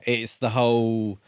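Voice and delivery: usual speaking voice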